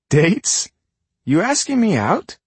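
amused surprise, rising high; surprised. Acted. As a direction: tease back in disbelief. Morning surprised at date.

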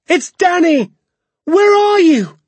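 high, loud cry for Dad; shouted call. A narrator's reading. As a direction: yell to a father somewhere in the woods. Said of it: "I shouted".

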